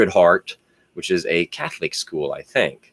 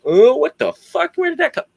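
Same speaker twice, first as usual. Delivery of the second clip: mock baffled outburst; 4 dB louder than usual. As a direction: play it shocked and confused, pitched way up. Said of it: "I don't get".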